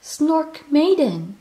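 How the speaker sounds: loud, high, slow call of a name; alarmed cry. Acted. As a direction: shout for her in alarm. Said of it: "Moomin gasped".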